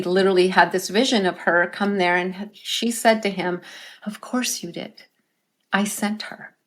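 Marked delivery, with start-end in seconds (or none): 4.0-6.5 soft, tender reassurance; gentle, softer. Retold or imitated. Dead wife gently reassuring her grieving husband, tender and soft. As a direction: say it softly, like a loving blessing.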